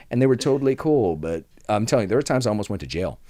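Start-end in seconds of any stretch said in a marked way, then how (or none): none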